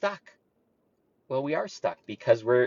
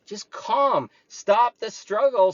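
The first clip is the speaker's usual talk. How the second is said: normal talking voice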